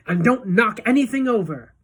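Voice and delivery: high, loud warning; shout. A narrator's reading. Wonka warns the children, shouting. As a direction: call it out sharply. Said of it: "shouted Mister Wonka".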